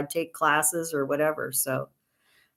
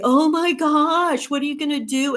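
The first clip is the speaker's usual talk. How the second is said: hammed-up dismay at someone having no insurance; mock alarm; 8 semitones higher, 4 dB louder than usual. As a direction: stretch out the shocked question like a fretting acquaintance. People reacting with alarm.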